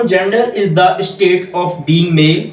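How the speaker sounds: very slow, every English word spaced out; slow, clear dictation for students to copy. Shown as a demonstration. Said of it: "फिर से repeat करता हूँ".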